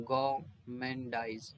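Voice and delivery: गाउमेंडाइज drawn out slowly with no r sound; slow careful गाउमेंडाइज, r left out. Shown as a demonstration. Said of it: "आर नहीं बोलेंगे". (गाउमेंडाइज बहुत धीमे, र बोले बिना)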